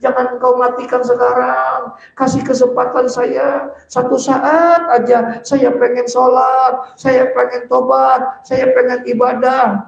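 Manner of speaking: high, sustained pleading chant; loud begging. Acted. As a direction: cry out each plea on one high, stretched note. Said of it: "Suara kita kenceng, kita minta tuh".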